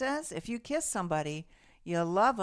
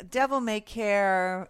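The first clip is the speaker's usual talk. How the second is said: drawn out; 4 dB louder, much slower than usual. Real, not acted. Savoring a carefree word.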